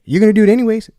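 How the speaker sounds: loud, high retort; retold protest. Eric refusing the agent's background check, loud protest. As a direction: throw the complaint back loudly.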